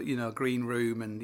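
ordinary delivery

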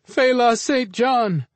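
a second desperate, drawn-out cry; terrified scream. Acted. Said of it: "the couple screamed".

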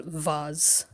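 vase said as "vahz"; British VASE. Shown as a demonstration. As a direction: say vase with a long AH and a Z. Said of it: "in British English".

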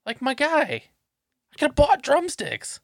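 high, loud exasperated rant. Real, not acted. Exasperated rant about the kid.

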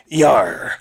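salty pirate aside; pirate diplomat. Acted. Pirate tells Sinead about her first mate, pirate voice. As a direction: report it in sea-dog drawl. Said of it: "like, be like".

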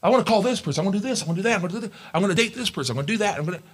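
rushed self-talk, high and eager; mock the hasty believer; impatient inner voice. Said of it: "don't just think".

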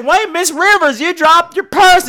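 high, urgent mock call; eager calling out. Fan calling after Joan Rivers with her purse, eager and high.

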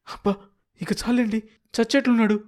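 wife begging her husband to stop the beating; pleading wife. Acted. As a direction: beg him to stop.

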